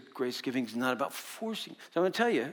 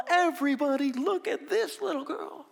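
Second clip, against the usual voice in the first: mock yell; 13 semitones higher, 3 dB louder than usual. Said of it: "I just wanted to yell to everybody in the mall".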